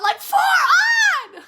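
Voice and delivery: high, loud squeal cracking into laughter; laughing shout. Real, not acted. Showing viewers her broken nails, a laughing squeal. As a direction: shriek it, embarrassed and cracking up.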